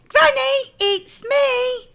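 put-on high, loud girl calling. A narrator's reading. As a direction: call out brightly to granny. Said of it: "she called out".

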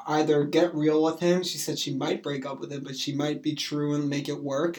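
ordinary talk

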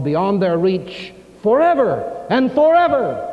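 rising, loud preaching voice; fervent preaching. Acted. Pastor warning the congregation about unbelief, fervent preaching. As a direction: preach it with urgency.

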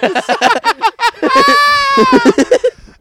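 very high, loud laughing fit; helpless laughter. Real, not acted. Podcaster loses it at co-host's joke, helpless laughter. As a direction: laugh until it squeals.

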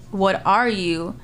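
normal talking voice

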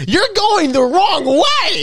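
high, slow prim voice mimicking the woman. Man mocks the woman who blocked the aisle, high and slow. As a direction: lift it up and drag it out like a scolding shopper. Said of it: "She said".

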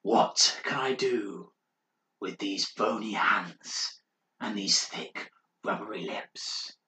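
slow, breathy whisper of a mad monk; eerie whisper. Acted. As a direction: creep out the listener with a slow ghostly whisper. Mad monk's eerie chant.

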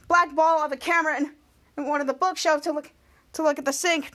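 ordinary talk

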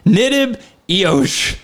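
slow, drawn-out backwards name; deliberate, spaced-out nonsense words; mock ominous reveal. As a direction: intone it like a fake incantation.

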